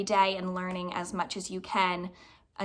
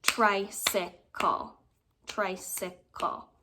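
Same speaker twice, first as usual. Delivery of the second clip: tricycle said very slowly, each beat clapped; slow clapped syllables; clapping syllables; much slower than usual. Shown as a demonstration. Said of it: "Let's clap it out".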